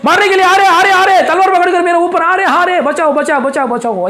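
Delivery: loud, very high panicked cries, easing toward the end; acted nightmare screaming. Preacher re-enacts the man waking at night, screaming in terror. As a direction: scream for help in mock terror, words tumbling over each other. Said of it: "ऐसे ही चिल्ला".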